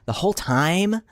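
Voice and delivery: slow, drawn-out mocking voice; exaggerated slow mimicry; mocking imitation. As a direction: stretch it out, imitating his way of talking. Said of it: "he's literally talking like this".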